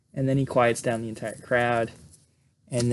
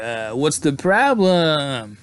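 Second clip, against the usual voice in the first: sleazy guy; 2 semitones higher, much slower than usual. Retold or imitated. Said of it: "Eddie's like".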